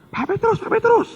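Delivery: calling out. Retold or imitated. Man chasing, calling out.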